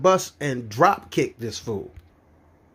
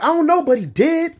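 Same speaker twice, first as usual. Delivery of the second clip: put-on high defensive kid; 13 semitones higher, 3 dB louder than usual. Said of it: "I'm like".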